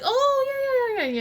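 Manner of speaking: high, loud mock realization; sudden recognition. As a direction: imitate someone suddenly placing a name. Imitates townspeople suddenly recognizing family.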